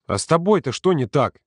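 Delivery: cross. A narrator's reading. Volodya confronts Yurka on the way to the kitchen, cross. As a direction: demand an answer crossly. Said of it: "спросил Володя сердито".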